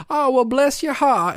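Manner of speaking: fake-sweet Southern lady; Southern sweetness. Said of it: "like Southern hospitality".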